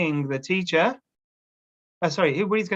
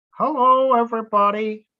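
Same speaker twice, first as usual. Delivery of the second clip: puppet voice; 8 semitones higher, 4 dB louder than usual. Pretend. Puppet Woody greets class.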